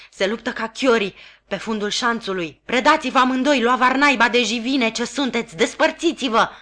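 loud barked orders; commanding shout. A narrator's reading. Sergeant ordering the fighting convicts apart, loud. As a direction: bark them apart like a drill sergeant.

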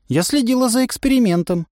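put-on high, prim girl's voice; girl's excuse. A narrator's reading. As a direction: justify yourself primly. Настя оправдывается. (высоко, важно)

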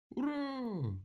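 very high drawn-out hurrah; gleeful cheer. A narrator's reading. Animals rejoice at the prison, cheering. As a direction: squeal a long happy cheer. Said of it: "обрадовались остальные звери".

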